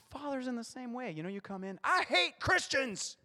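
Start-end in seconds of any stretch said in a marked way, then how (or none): 1.8-3.2 high, loud, slow sulky whine; whiny childlike complaint. Retold or imitated. Believer whining to the Father about Christians: high, slow, loud. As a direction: drag it out like a pouting child.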